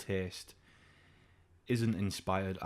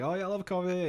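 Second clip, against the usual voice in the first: eager coffee order; 9 semitones higher, faster than usual. Retold or imitated.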